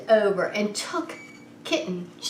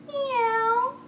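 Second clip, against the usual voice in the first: high, thin kitten mew; kitten meow. Acted. Reading to children, voicing the kitten's meow.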